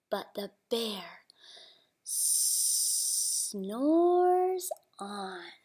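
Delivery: hushed storytelling whisper. A narrator's reading. Reading to a child, hushed whisper. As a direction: whisper it so the bear stays asleep.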